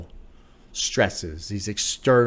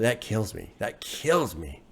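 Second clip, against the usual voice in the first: normal talking voice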